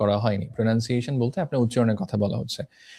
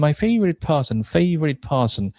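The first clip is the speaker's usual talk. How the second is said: FAVORITE PERSON punched higher; stress on content words; 4 semitones higher, much slower than usual. Shown as a demonstration. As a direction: hit "favorite person" hard with raised pitch. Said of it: "এই যে ওয়ার্ডগুলোতে জোর দেয়া".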